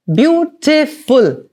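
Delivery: slow, syllable by syllable BEAUTIFUL; clapped out slowly. Shown as a demonstration. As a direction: stretch "Beautiful" into three clear beats for clapping. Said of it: "Three claps, three syllables".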